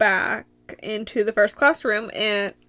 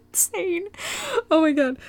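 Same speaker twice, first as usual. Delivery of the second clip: high laughing voice, louder; 9 semitones higher, 8 dB louder than usual. Real, not acted. Vlogger cracking up at herself, laughing. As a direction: giggle through the words. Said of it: "I'm talking like a robot".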